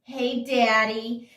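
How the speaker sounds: slow, playful mock-singer call. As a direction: stretch it out like the song's hook. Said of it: "she goes".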